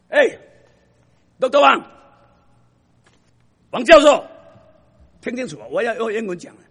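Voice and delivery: loud, high, indignant retort; shouted outrage from a student corrected on his English; angry student. Retold or imitated. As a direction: hurl it back at the professor. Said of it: "这个人很不高兴".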